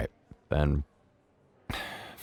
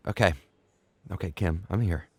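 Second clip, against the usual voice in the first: soothing father; 5 semitones lower, 9 dB quieter than usual. Acted. Father comforting crying daughter.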